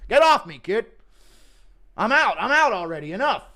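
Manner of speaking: put-on lost old man pushing a kid away.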